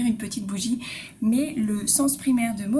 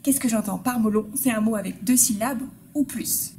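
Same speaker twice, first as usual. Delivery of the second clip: ordinary talk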